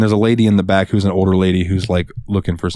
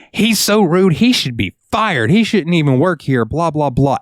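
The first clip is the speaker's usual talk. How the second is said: somewhat higher whiny complaint; mock complaining lady; 7 semitones higher than usual. Cashier mimics a lady badmouthing him in a higher whine. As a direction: grumble like a gossiping customer. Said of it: "just saying".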